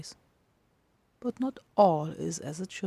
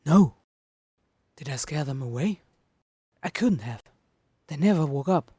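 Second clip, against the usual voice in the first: rising to a panicked near-shout, faster at the end; ragged panic; 9 dB louder than usual. Acted. Monster alone in the empty room, panicking, building to a loud cry. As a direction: panic climbing line by line until it breaks loud. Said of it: "His breathing starts to become ragged".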